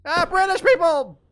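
loud surprised exclamation; excited outburst. Real, not acted. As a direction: burst out with it. Sudden outburst at game event.

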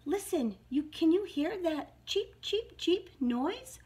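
high, urgent little mouse; mildly excited mouse cry. A narrator's reading. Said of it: "Mouse cried".

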